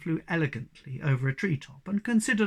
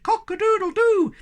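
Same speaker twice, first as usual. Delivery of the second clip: much higher, louder rooster crow; crowing shout; 8 dB louder than usual. Acted. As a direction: crow it at the window. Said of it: "he shouted".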